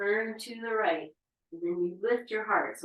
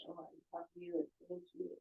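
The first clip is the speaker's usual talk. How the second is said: tender whisper; 12 dB quieter, faster than usual. Real, not acted. Talking tenderly to own belly.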